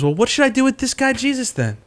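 mock Pilate. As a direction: ask it up high, throwing up your hands. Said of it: "then he says".

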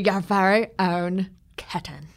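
hushed whisper of a teacher; teacher's whispered gift; whispered teacher line. Retold or imitated. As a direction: whisper it like a kindly headmistress. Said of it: "That's how she says it".

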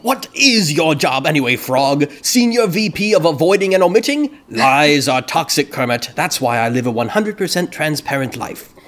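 lightly voiced theatrical villain, rising high on the job title; a hint of a haughty Uncle Deadly scolding Kermit; Uncle Deadly line. Podcast host reading the quote, scolding Kermit in a light Uncle Deadly voice. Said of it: "That was a great Uncle Deadly impression".

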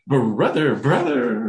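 high, loud eager call of brother; excited brother. Retold or imitated. Younger brother greeting him at the door, excited and high. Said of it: "And he's like".

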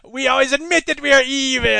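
loud, high booming villain boast; cartoon villain. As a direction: proudly declare it like a cartoon baddie. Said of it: "in Transformers land".

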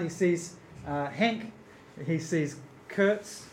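normal talking voice

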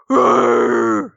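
low growling rawr; deep animal roar; bison roar. Shown as a demonstration. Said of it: "so the rawr is like".

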